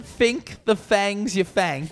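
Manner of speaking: "Think the fangs you thank" drawn out slowly, th said as f; high, slow "Think the fangs you thank", with "thank" as "fank". Shown as a demonstration. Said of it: "So if you write it out".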